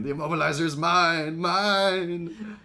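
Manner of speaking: mock whine of a spoiled child; whiny Megatron. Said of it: "being very whiny".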